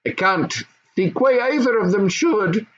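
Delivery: high, firm goblin mother. Acted. Goblin mother agreeing, high and firm.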